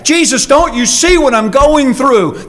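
pleading complaint. Acted. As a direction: plead as a hurt believer would. Tempted believer pleading with Jesus.

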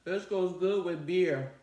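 talking while chewing food; words muffled by mouthful; mouth full, chewing. Real, not acted. Seen 3: Forkful of salad eaten at -3; cheeks full, chewing while speaking.

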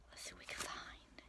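soft, breathy whisper while sneaking. Real, not acted. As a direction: whisper as if the leprechauns might hear. Sneaking up on leprechauns.

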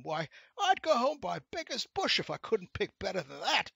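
high, loud protesting voice; whiny rascal. A narrator's reading. Rascal denies picking the gentleman's pocket, whiny protest. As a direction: whine indignantly against the charge. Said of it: "said the rascal in a whiny voice".

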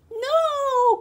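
high, loud cry of alarm. Acted. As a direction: yell it out in fright. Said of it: "cried the witch".

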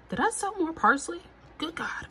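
high, quick surprised exclaim. Real, not acted. Gardener spotting parsley she forgot sowing, surprised high exclaim. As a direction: pitch jumps up in delighted disbelief.

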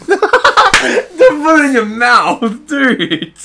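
high laughing warning. Real, not acted. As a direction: laugh through the words, slowly. Laughing at the other.

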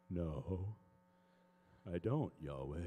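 very low-pitched answer; reply deep and dumbly to God; low, sheepish admission; baffled reply to God. Retold or imitated.

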